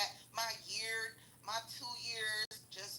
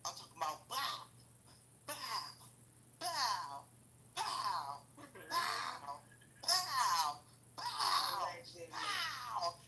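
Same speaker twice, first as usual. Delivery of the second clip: punch sounds; much slower than usual. Retold or imitated. Mimicking punches, sound effects.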